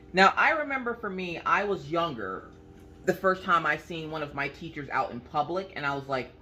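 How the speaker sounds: usual speaking voice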